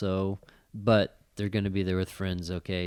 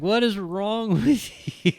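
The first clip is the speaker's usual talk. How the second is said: high, slow, laughing disbelief; 12 semitones higher, slower than usual. Retold or imitated. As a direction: laugh through the question. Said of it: "And I'm like".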